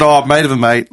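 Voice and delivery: normal talking voice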